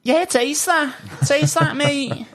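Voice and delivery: put-on high-pitched Liverpool Scouse accent. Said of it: "My Scouse accent".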